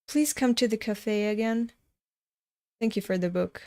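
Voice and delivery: usual speaking voice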